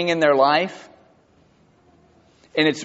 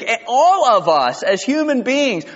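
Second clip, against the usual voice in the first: ordinary delivery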